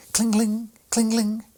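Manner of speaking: high, loud chiming bell sound; shrill bell ring. A narrator's reading. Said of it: "a shrill sound broke upon their ears".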